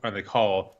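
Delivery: normal talking voice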